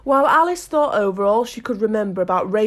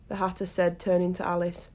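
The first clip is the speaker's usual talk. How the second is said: plain reading voice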